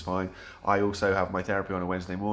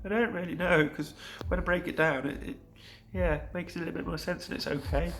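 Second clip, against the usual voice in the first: higher, hesitant voice for your own inner answer; unsure answer; 8 semitones higher than usual. Retold or imitated. Answering your own question about wild emotions, hesitant and higher. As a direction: play the self who half-knows, tentatively. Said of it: "And you're like".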